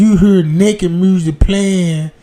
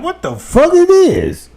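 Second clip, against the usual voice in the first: shocked; 8 semitones higher than usual. Retold or imitated. Shocked at finding the toy.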